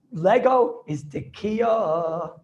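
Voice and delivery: each word laid out very slowly; slow emphatic quote. Shown as a demonstration. Teacher quoting the sefer's ruling to students, very slow and weighty. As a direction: read the ruling out slowly and deliberately.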